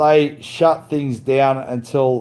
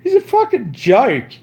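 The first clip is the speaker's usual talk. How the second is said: laughing it out, much higher and in a different timbre; laughing scorn; 13 semitones higher than usual. Real, not acted. Streamer laughing at his crypto rival, high mocking laugh. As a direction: cackle the insult through real laughter.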